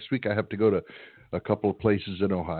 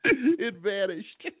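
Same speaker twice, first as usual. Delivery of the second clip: laughing; 9 semitones higher, much slower than usual. Real, not acted. Laughing reply to caller joke.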